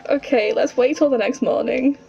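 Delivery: ordinary talk, nothing marked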